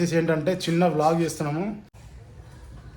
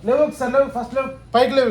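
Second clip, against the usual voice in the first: higher-pitched urging to get up; wake-up call; 6 semitones higher than usual. Real, not acted. Parent calling sleeping children awake.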